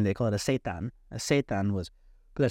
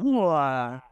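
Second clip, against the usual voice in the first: a loud, strange drawn-out wah; imitated healer's cry; 4 semitones higher, 4 dB louder than usual. Healer pulling dark energy out, a loud eerie wah. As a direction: mimic the healer's eerie wail. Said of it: "And he's still going".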